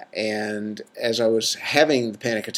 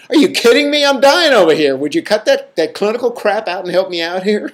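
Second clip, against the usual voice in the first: much higher and louder, frantic exasperated outcry; the panicking self yelling at the calm clinician; mock-frantic high yell of protest; freaking out; 10 semitones higher, 7 dB louder than usual. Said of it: "that was freaking out was going".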